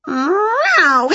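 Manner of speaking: high startled meow; a cat yowling in fright. A narrator's reading. Wilbur the cat shocked by Winnie's face, high meow.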